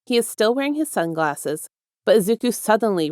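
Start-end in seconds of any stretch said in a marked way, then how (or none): none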